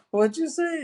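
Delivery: very high, put-on scandalized voice; hammed-up high-pitched challenge; mock outraged aunt. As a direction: imitate an indignant aunt in falsetto. Acted quarrel, aunt demands repeat.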